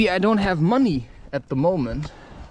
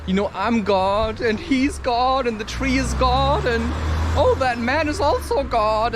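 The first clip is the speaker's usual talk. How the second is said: mock-elated, pitched higher as it builds; elated realisation; 6 semitones higher than usual. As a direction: act out rising spiritual wonder. Voicing blissed-out spiritual realisation.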